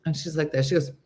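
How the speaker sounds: usual speaking voice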